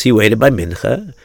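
ordinary delivery, nothing marked

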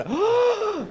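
a sharp, high, hammed-up gasp; mock gasp. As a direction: gasp as if scandalized, for fun. Mock shock at the topic.

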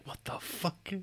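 shocked whisper. Retold or imitated. Wife walks in on handshake.

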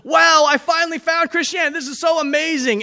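high, loud imitation of an overjoyed friend; hammed-up thrilled outburst; fired up. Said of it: "he's so fired up".

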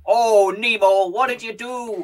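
an odd Irish leprechaun lilt; hammed-up Irish accent. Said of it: "suddenly some sort of odd leprechaun man".

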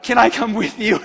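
laughing as you beg to tag along; laughing mock plea. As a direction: ask it through a laugh. [name] joking about leaving too.

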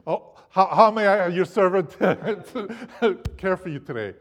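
hammed-up servile plea, breaking into laughs; mock groveling. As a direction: beg like a servant, half laughing. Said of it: "on my knees and say".

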